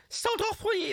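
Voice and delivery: very high, squealed Scouse; piercingly high Liverpool accent; high-pitched modern Scouse. Shown as a demonstration. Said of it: "the high pitched".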